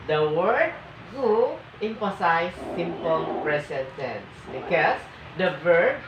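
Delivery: ordinary talk, nothing marked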